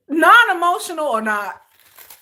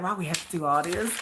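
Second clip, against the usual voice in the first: lower, softer grumble; mock reluctant boyfriend; 9 semitones lower, 9 dB quieter than usual. [name] grumbling at his girlfriend's hug request, low and reluctant. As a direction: grumble like you'd rather not.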